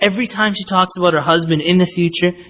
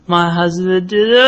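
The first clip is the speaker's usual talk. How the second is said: mock crying; 3 semitones higher than usual. Wife complaining to anyone about her husband, mock crying. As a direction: imitate a wife breaking into tears. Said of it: "and then she starts crying".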